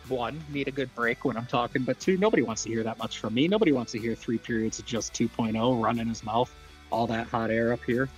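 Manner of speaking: ordinary talk, nothing marked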